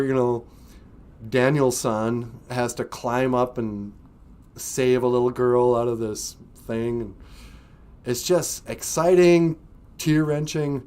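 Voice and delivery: usual speaking voice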